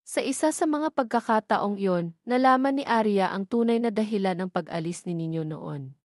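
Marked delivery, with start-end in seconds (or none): none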